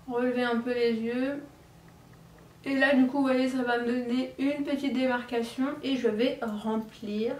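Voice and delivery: ordinary delivery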